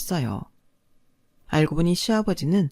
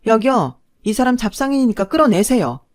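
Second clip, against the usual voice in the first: higher, louder call summoning help; raised-voice order; 4 semitones higher, 7 dB louder than usual. A narrator's reading. Ex-wife calling security on ex-husband, commanding shout. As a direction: call out firmly to have him removed. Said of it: "제가 그렇게 소리를 지르자".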